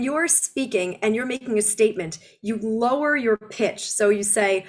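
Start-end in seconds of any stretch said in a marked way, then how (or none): none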